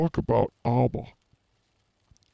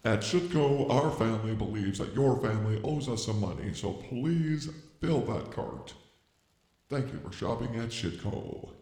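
soft, hushed commercial voice; mock ad read; 4 semitones lower, 9 dB quieter than usual. Husband spoofing a store commercial, soft ad voice.

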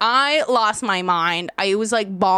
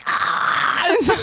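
ordinary talk

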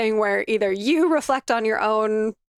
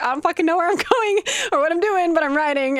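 gleeful tricycle rider; 8 semitones higher than usual. Retold or imitated. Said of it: "I'm like".

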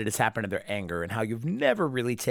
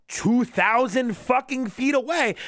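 high, slow, incredulous outburst; exasperated emphasis; 10 semitones higher, slower than usual. Real, not acted. Reviewer outraged at how far Mai can see, exasperated. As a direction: draw out each word, pitched high in exasperation. Ranting at the absurd distance.

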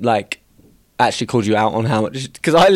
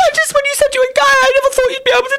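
high mock mum voice; 4 dB louder than usual. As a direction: play the clueless mum, high. Parent voiced with laugh.

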